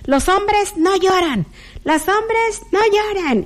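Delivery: high mock scold of old-fashioned parents; singsong parental reprimand; parents' scolding. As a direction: imitate the parents telling boys not to cry. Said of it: "¿qué decían nuestros padres?".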